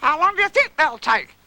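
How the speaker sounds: high, eager little voice; high-pitched curious question; Roo. Acted. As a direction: ask it in a childlike squeal. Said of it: "asked Roo".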